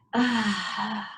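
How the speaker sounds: a long, audible out-breath sigh; demonstration sigh. Shown as a demonstration. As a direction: let it all out in one sigh. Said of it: "let it out with a sigh".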